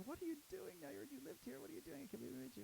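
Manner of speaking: very high thrilled fan voice; starstruck fan. Retold or imitated. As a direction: squeal with disbelief. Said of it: "he's like".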